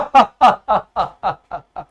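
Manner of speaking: a full, hearty laugh acted out for the postman. Teacher acting out the postman's hearty laugh at the letter. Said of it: "वो भी जोर जोर से हंसने लगा".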